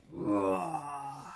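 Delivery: slow build into a low roaring "roar"; drawn-out roar. A narrator's reading. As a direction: let the word roar out like a lion. Reading the lion's loud roar.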